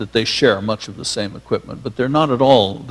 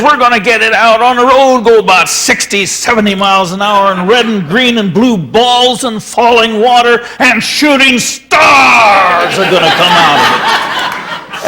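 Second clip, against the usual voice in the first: loud and high, swelling into an ecstatic half-shout with a laugh; Rexroth's way of talking; 8 semitones higher, 7 dB louder than usual. Retold or imitated. Poet imagining the car shooting stars, ecstatic booming shout. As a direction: build it up like a visionary prophecy. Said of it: "he talked very much like this".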